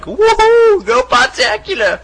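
high hammed-up whoop; cheering. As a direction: cheer for the show. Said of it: "he's like".

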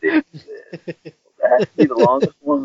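high, drawn-out gasping oh my God; shocked exclamation. Real, not acted. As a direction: react in real disbelief. Shocked reaction to long story.

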